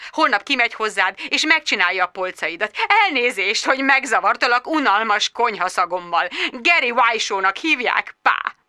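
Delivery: ordinary delivery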